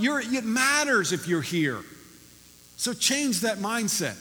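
fervent exhortation. Real, not acted. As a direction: drive the point home to the church. Pastor exhorting congregation.

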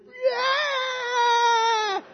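high, loud, drawn-out cheering yell; all-out celebration yell while holding a baby; loudest scream. Retold or imitated. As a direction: let the whole room hear the cheer. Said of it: "the loudest scream".

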